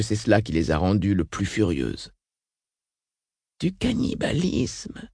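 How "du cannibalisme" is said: soft, high, drawn-out murmur. A narrator's reading. Tamalan reacts to bodies fed to futards, murmuring. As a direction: murmur it slowly, pitched up and hushed. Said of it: "murmura Tamalan".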